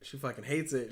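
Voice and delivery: normal talking voice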